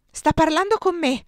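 high, loud cry; shouted. A narrator's reading. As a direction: call up to be recognised. (gridato con voce acuta)

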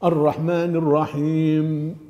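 "الرحمن الرحيم" slowly, closing on a held m; clear final letter. Shown as a demonstration. Teacher modelling recitation, last letter clearly sounded. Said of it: "يجب أن يظهر ساكنا".

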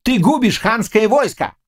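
high-pitched, furious accusation; angry cry. A narrator's reading. Tatar blames Khmelnitsky for ruining the army, angry cry. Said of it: "крикнул Тугайбей".

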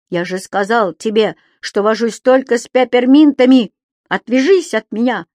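loud, high scolding rant; indignant yelling. Acted. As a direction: tell the thief off at full voice. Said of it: "возмущённо закричал Субастик".

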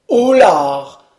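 loud yelp of pain; sudden cry. A narrator's reading. Punched servant yelling out loud. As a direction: yell out when punched in the eye. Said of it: "cria-t-il".

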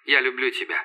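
subdued words through radio filter; voice in headphones. A narrator's reading. Andrew's final words to Iva, radio voice. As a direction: say it simply over the comms. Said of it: "в наушниках".

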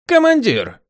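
high loud call. Acted. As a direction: call out sharply. Calling out to commander.

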